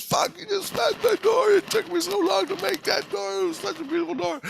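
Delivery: high-pitched mock wailing, loud; hammed-up tearful shriek over a ruined treasure; crying meltdown. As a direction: imitate a man freaking out in tears. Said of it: "he's fucking crying".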